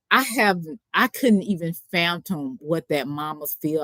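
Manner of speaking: ordinary delivery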